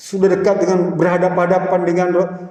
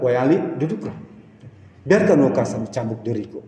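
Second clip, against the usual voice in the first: calm Prophet; 6 semitones lower, 4 dB quieter than usual. Acted. Preacher voices the Prophet.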